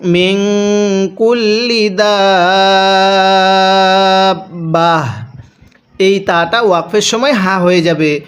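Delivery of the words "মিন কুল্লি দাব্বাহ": tajweed recitation. Shown as a demonstration. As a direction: stretch each vowel, stop on দাব্বাহ. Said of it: "তাহলে কিভাবে পড়ব?". (ওয়াকফ করে তিলাওয়াতের সুরে)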